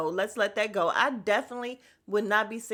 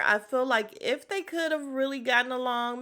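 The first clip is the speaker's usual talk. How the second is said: ordinary talk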